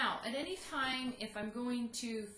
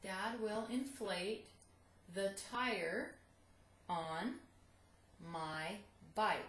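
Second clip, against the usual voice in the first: slow read-through; much slower than usual. Shown as a demonstration. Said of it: "To practice".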